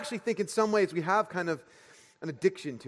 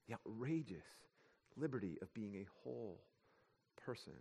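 much softer, each word set apart; slow hushed repeat; 8 semitones lower, 19 dB quieter than usual. Shown as a demonstration. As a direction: let it sink in, almost a breath.

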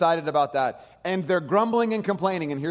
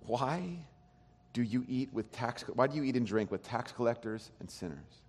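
soft, low grumbling question; muttered complaint; 3 semitones lower, 9 dB quieter than usual. Retold or imitated. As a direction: mumble it under your breath. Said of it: "they say".

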